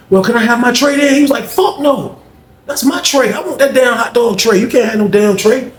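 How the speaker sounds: high, pushy back-and-forth voices; mock prison squabble over a tray; tray argument.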